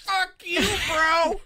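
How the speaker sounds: high, loud cocky snap; mock defiant yell. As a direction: spit it at the alien doctors. Walton voiced refusing the aliens.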